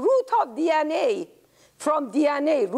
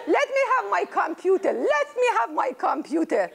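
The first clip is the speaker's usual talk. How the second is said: laughing; 7 semitones higher, faster than usual. Acted. Grandma laughing demanding computer.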